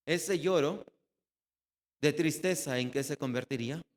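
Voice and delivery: usual speaking voice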